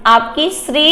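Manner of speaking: normal talking voice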